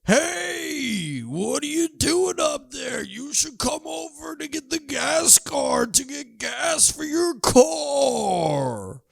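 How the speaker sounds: teasing holler at a graduation; mock yell. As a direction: call up to the brother from far below. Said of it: "those are pretty much both of them".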